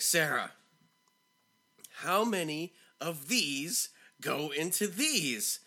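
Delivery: teacher getting mad. Retold or imitated. Teacher losing patience with Sarah, slow and getting mad. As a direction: imitate mounting anger, each word spaced out. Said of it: "He's starting to get mad".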